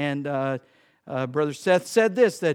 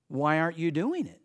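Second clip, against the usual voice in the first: ordinary delivery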